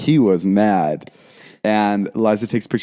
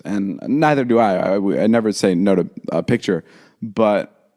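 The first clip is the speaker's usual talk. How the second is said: ordinary delivery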